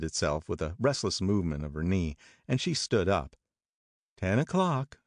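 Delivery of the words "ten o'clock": put-on a touch higher, offhand young woman; Jordan remarking. A narrator's reading. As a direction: Jordan noticing the time, lightly voiced. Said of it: "she remarked".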